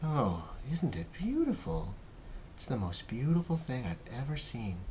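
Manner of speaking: soft, awed murmur to oneself; spellbound murmur. A narrator's reading. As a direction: say it quietly, lost in wonder. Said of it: "murmur quietly to himself".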